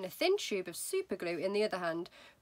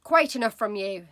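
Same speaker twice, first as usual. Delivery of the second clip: loud curt rebuke; mother snapping; 10 dB louder than usual. Acted. Embarrassed mother silencing daughter, loud and curt. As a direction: shut her daughter up, louder. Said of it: "said Missus Wormwood".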